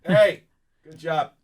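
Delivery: high call from the next room, fading; from another room. Retold or imitated. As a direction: shout it faintly through the wall. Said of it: "from the other room".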